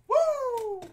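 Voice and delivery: high, loud whoop; real joyful whoop; real cheer. Real, not acted. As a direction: let out a victory whoop. Cheering a big card pull.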